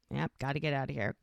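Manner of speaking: quick, low, soft aside; hushed, hasty and matter-of-fact, retold; low-key retreat. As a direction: imitate a hurried exit under the breath. Friend backs out with drunk man.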